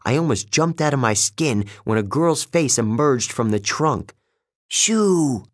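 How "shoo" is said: high, sharp dryad voice; snappy dryad. Acted. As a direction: shoo her off like a tree spirit. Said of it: "she said".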